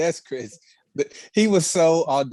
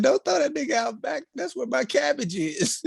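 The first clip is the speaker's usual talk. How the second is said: quoting the show; 11 semitones higher than usual. Retold or imitated. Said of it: "She said".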